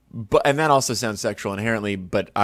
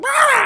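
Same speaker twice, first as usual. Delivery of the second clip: a very high, loud screeching yowl; cat screech; 7 dB louder than usual. Retold or imitated. Host imitates the cat screeching at the hose. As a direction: shriek it like a cat hit by the hose. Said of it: "and he goes".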